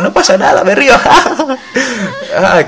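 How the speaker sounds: put-on high falsetto girl voice breaking into a giggle; giggling girl. Girl reassures the boy, high falsetto giggle. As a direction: play the girl laughing it off, way up high.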